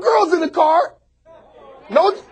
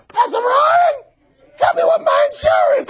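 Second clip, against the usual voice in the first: mock pleading Jimmy; 11 semitones higher than usual. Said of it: "coming to me talking about".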